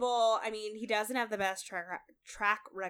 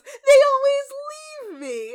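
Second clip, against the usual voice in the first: high, loud wail sinking down; hammed-up tearful complaint, half laughing; mock-earnest lament; 13 dB louder than usual. Retelling a contestant's heartfelt breakup answer, mock-earnest lament. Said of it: "he says it with, like, full sincerity".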